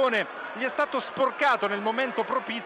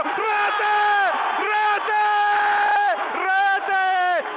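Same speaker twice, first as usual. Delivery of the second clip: screamed goal call; goal shout; 13 semitones higher, 7 dB louder, much slower than usual. Real, not acted. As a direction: yell it out full force.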